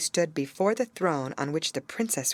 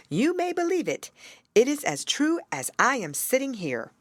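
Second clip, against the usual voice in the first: higher raven voice; raven insisting; 7 semitones higher than usual. Acted. Raven assures Gerda it is true, higher.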